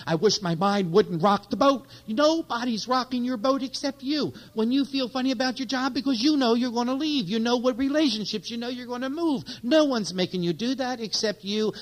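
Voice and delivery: high, loud preacher's push; fervent sermon climax; impassioned preaching. Acted. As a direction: drive it home to the congregation. Preaching at full force.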